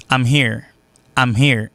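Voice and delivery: "I'm here" as "am here"; reduced I'm. Shown as a demonstration. Teacher showing accent, "I'm here" said as am here. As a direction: open "I'm" to am, no glide. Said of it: "il sorti I'm".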